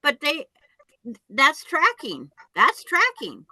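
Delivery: exasperated, insisting they are right; insistent protest. Real, not acted. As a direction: argue the point, pitch climbing. Insisting in argument over phone tracking.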